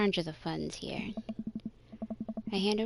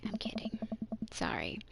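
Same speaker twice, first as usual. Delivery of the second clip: hushed apologetic whisper; 3 semitones higher, 6 dB quieter than usual. Acted. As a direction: whisper it so only she hears.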